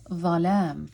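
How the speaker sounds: ordinary talk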